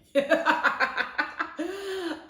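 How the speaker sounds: a long, high peal of laughter. Real, not acted. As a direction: laugh at how absurd it sounds. Laughs after friends' quote.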